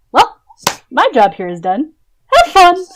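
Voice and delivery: loud, rising to a high cheery send-off; bright exclaimed exit line; breezy farewell. Acted. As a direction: toss out a goodbye as you dash away. Kairi breezily leaves them behind.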